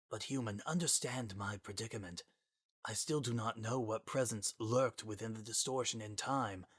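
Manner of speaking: soft, grave and formal; solemn Gaster voice. Acted. Sans voicing Gaster's words.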